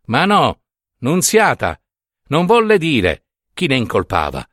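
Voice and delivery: ordinary delivery, nothing marked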